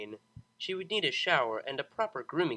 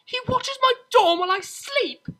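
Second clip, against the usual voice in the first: high, loud outraged cry; almost screaming it out; shrill outburst of disbelief; near scream; 11 dB louder than usual. Acted. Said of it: "resisted an urge to scream".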